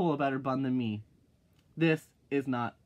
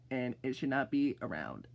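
ordinary delivery

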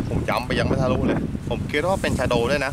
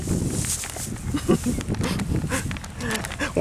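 breathy laugh; 10 semitones higher, 4 dB quieter than usual. Real, not acted. Lost glasses mid-fight, laughs.

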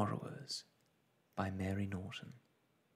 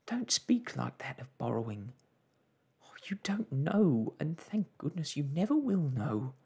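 higher, swooping mother's plea; distressed mother; 6 semitones higher, 4 dB louder than usual. Acted. Mother warns daughter off borrowing, distressed. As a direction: warn her with heavy feeling.

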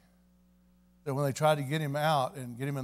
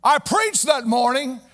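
high, loud preacher's shouted climax; shouted revival preaching, slow on the calls; preaching at full force; 10 dB louder than usual. Acted. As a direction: whip the congregation into praise. Preaching climax, calling for praise.

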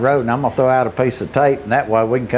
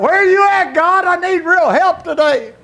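crying out. Retold or imitated. Preacher reliving his plea to God for his son, crying out. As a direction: cry it out at full pitch, pleading. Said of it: "I cried out".

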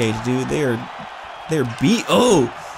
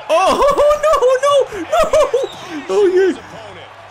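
very high, drawn-out laughing wail; real laughing panic; panicked laughing; 3 dB louder, much slower than usual. Real, not acted. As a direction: wail through helpless laughter. Laughing in panic while being hit.

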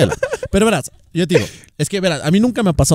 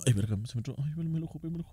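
1 quejido por dolor en el ojo; 18 dB quieter, faster than usual. Real, not acted. Le entró algo al ojo.

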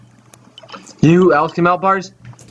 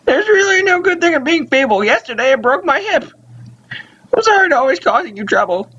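put-on feeble old mother. Ailing mother welcoming Davey home, feeble old-lady voice. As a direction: put on a frail, high old woman. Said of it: "You do the voice".

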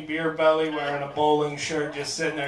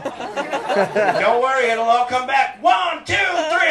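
high, loud, slow reassurance then counted yell; instructor countdown shout; 9 semitones higher, 9 dB louder, slower than usual. Retold or imitated. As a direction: shout the count over the wind.

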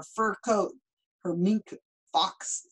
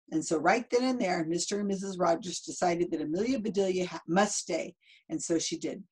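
usual speaking voice

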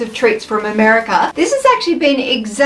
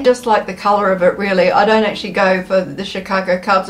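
ordinary delivery

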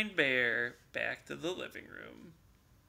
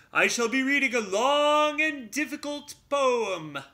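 pompous bear; 9 semitones higher, 7 dB louder than usual. Acted. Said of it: "continued Bear".

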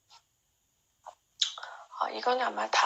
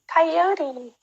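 가이요리 pitched higher, 요 stressed; 6 semitones higher, slower than usual. Shown as a demonstration. Teacher contrasting stressed 가이요리 take. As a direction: push 요 up and out. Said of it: "요의 강세가 있어요".

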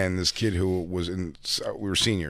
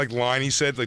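ordinary delivery

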